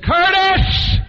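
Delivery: high, loud drawn-out holler of a name; yelling across room. Retold or imitated. Friend calls across two hundred diners, yelling. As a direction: holler to someone across a crowded cafeteria. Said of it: "yelling across the people".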